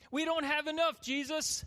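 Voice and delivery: high, loud, worried protest to Jesus; protesting disciple. Acted. Philip objects to Jesus about feeding the crowd, high-pitched protest. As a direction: voice pushed up in urgent objection. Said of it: "Philip is saying".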